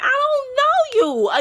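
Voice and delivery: high and slow; incredulous retort. Retold or imitated. Answering the girl's apology, exasperated. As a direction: stretch it out in disbelief.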